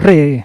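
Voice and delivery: proud first trilled رے; first correct r. Retold or imitated. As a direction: land رے with a clean rolled r. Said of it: "تو میرے منہ سے نکلا".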